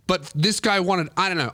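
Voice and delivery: ordinary talk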